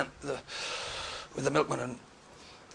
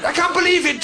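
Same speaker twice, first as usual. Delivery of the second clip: ordinary talk, nothing marked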